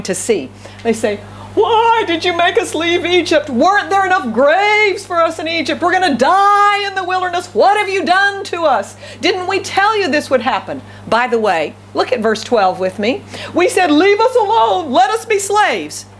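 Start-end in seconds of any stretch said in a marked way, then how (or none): 1.6-10.8 mock complaining. Israelites' panicked complaint acted out. 13.5-16.1 high-pitched plaintive protest, dragging at the end; mock complaining. Israelites' complaint acted out.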